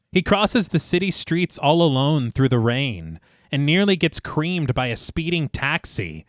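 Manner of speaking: ordinary delivery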